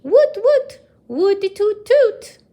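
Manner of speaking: high loud woots; cheering. Acted. The pupils whoop for Watney, excited. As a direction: whoop like a happy crowd.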